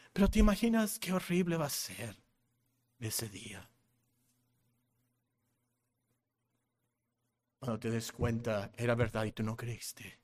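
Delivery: low, very soft, grave; hushed warning. Real, not acted. Preacher warns those who did not believe, hushed and grave. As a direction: drop right down and let it sink in.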